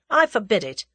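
firm, loud command; dictatorial. A narrator's reading. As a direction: forbid it like a dictator. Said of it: "he said dictatorially".

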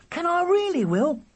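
put-on gasp, then very high amazed child; thrilled. Charlie offered William's Instamatic, thrilled. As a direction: squeal it with breathless delight.

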